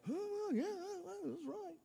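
put-on tiny high, soft kid voice; put-on high-pitched, hushed agreement; children agreeing. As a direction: mimic kids agreeing in a small squeak. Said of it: "your children are sitting back going".

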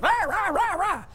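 high-pitched ranting imitation; mock rant. Preacher shows the congregation what a rant is, mock ranting. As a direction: imitate someone ranting in high nonsense syllables. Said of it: "somebody goes on a, a, a rant".

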